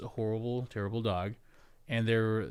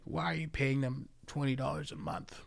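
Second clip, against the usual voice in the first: imitating wife; 3 semitones higher, 6 dB quieter than usual. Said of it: "my wife was like".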